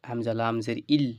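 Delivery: ordinary talk, nothing marked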